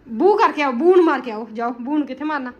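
loud playful urging; dramatic loud aunty. Real, not acted. Loud play with baby.

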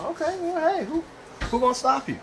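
high-pitched, playful egging-on; playful hype. Real, not acted. Host egging on the cook over spicy lime, high playful hype. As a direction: jump way up high to cheer them on.

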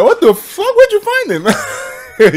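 very high-pitched, incredulous squeal; hammed-up disbelief in a high voice; mock shocked partygoers. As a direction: imitate appalled guests, pitch shooting way up. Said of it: "They'll be like".

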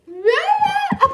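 high, loud scream; startled scream. Real, not acted. Girl screaming as she tumbles over. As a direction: scream as you fall.

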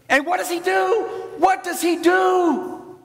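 high, slow dramatic question; preacher's high drawn-out challenge; rhetorical build. Acted. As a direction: drag it out high to build suspense. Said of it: "preacher's rhetorical build-up".

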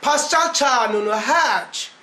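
put-on slow Erzurum accent, "Pascal kanunu" said as "Paçal çanunu". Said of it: "Erzurum şivesiyle".

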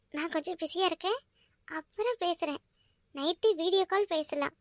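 woman softly reading a message from her friend. Acted. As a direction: read the text half aloud, softly.

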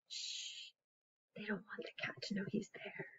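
hushed whisper to keep a secret. Acted. Hiding the mouse from the cat, hushed whisper. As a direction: whisper it so the cat won't hear.

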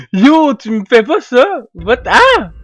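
high, loud outraged shout; outraged surprise. Real, not acted. Gamer yelling at a player who attacks him, outraged shout. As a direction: cry out at the betrayal.

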